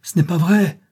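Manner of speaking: acted shout of denial; desperate cry. A narrator's reading. Poor woman denies the sorcerer's charge, crying out. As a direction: cry out high and loud. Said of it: "cria la pauvre femme".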